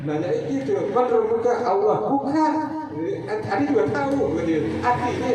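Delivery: high, lively teasing questioner; lightly voiced strict examiner; mock quiz. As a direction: play the examiner catching the answer out. Acted teacher-student exchange.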